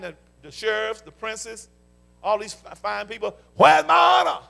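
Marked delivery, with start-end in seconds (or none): 3.5-4.4 high, loud shout as God; a full preaching shout; shouted demand. Acted. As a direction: roar the question at the crowd. Preacher shouts God's question.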